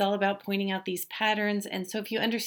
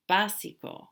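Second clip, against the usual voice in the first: "básico" stressed on "bá"; careful model of "básico", strong opening syllable; stress on first syllable; 2 semitones higher than usual. Shown as a demonstration. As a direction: hit the first syllable of "básico", let the rest fall away. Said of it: "so we know how to say it".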